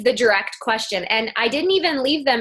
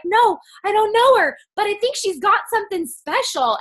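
excited reply; 10 semitones higher than usual. Retold or imitated. Retold reply on the phone.

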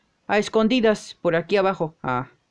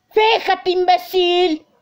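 high, loud furious yell at the driver; angry shout; 12 semitones higher, 5 dB louder, slower than usual. Acted. Character yells at the train that almost hit him, furious. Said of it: "Por eso dije así gritando".

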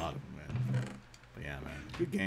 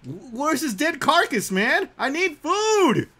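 high, loud, drawn-out wail; mock desperate yell; 10 semitones higher, 8 dB louder, much slower than usual. Player who needs food from a dead bunny, mock desperate yell. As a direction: yell in exaggerated despair, slowly.

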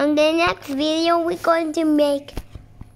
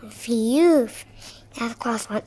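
normal talking voice

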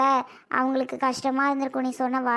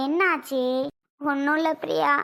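usual speaking voice

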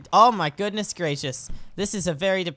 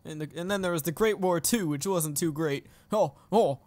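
put-on old man; 2 semitones lower, 4 dB quieter than usual. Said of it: "Come on, old man".

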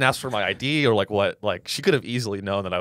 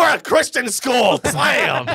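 high, slow, shouted rejection; shouting rejection; 13 semitones higher, 5 dB louder, much slower than usual. Pretend. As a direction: shout it and slam the phone. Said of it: "I would've been like".

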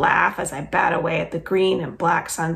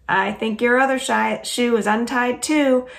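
hollering; 5 semitones higher, 4 dB louder than usual. Acted. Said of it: "Bai hollers back at me".

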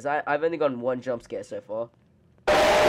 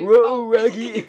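high, loud goofy cartoon howl; cartoon impression; 6 semitones higher, 6 dB louder, much slower than usual. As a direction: drag it out like a cartoon character. Mimics a cartoon voice at lookalike.

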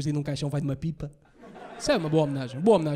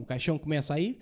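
ordinary talk

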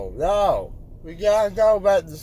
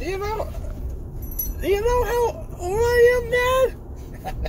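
high, tearful indignant whine; whiny crying protest; 11 semitones higher than usual. Real, not acted. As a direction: protest higher, on the verge of tears.

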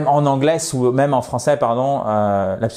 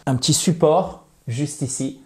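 ordinary talk, nothing marked

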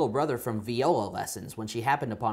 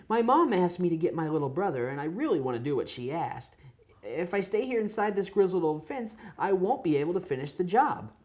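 high puppy voice; 6 semitones higher than usual. Acted. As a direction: squeak it out like a shy little pup.

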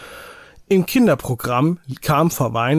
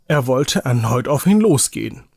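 plain reading voice

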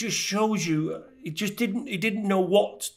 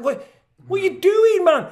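mock Bush; 6 dB louder than usual. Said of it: "he was like".

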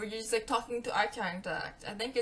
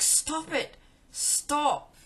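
annoyed stop; 5 semitones higher, much slower than usual. Retold or imitated. Telling him to stop.

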